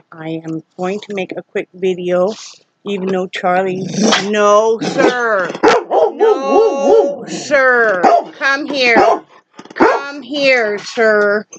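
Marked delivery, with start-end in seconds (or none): 4.3-11.5 sharp, higher no sir to a dog; firm scolding. Real, not acted. Owner scolding her misbehaving dog Charlie, firm and sharp. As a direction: scold the dog to come back.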